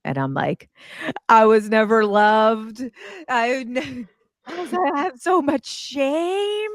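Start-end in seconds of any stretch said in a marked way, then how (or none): 1.3-6.7 hammed-up high, drawn-out wail; acted-out meltdown. Speaker replaying her meltdown on the drive home, mock wailing. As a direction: wail it slowly like a mock breakdown. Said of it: "I have a complete meltdown".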